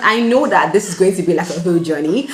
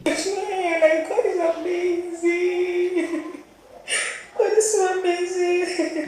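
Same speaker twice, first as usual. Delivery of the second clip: high, drawn-out sobbing wail; crying; 12 semitones higher, 4 dB quieter, much slower than usual. Real, not acted. Author praising God on camera, really crying. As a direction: weep the words out very slowly. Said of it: "I cried on YouTube".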